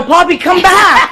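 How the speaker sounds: mock desperate come-back call; mock calling after someone. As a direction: call out as if they are leaving. Calls after Poppy, mock pleading.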